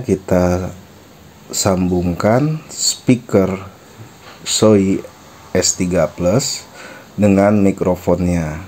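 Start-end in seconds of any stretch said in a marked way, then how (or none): none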